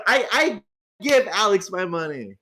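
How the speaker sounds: ordinary delivery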